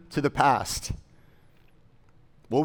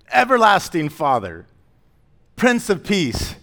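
louder, higher, measured proclamation of the verses; scripture recitation; 5 semitones higher, 6 dB louder than usual. Acted. Pastor reciting Isaiah from memory, louder proclamation. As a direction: declaim each name of the child with rising awe.

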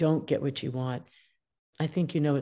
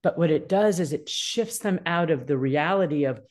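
usual speaking voice

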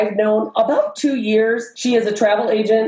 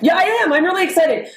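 genuinely excited; 8 semitones higher, faster than usual. Real, not acted. Insisting on her excitement.

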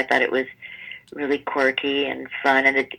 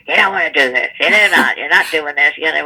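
loud, gruff director imitation; mock gruff grumbling, louder; big gruff voice; 6 dB louder than usual. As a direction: bark it gruffly, running over objections. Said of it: "he has a big gruff voice".

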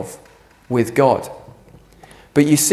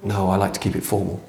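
soft stiff reply; 7 semitones lower, 7 dB quieter than usual. Pretend. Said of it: "using it back on him".

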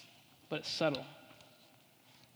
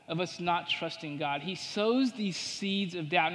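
ordinary talk, nothing marked

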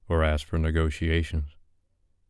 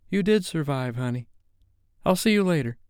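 much higher, bright and affectionate; bubbly friend voice; 10 semitones higher than usual. A narrator's reading. Tara reassuring Sophie before being led away, affectionate. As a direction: lift it high and sweet for the friend.

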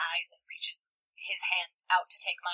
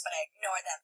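ordinary delivery

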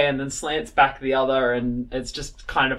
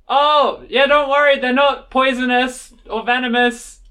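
raised high voice shouting upstairs; calling out from above; 9 semitones higher, 6 dB louder than usual. Acted. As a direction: call down from the top. Wizard calls from tower top.

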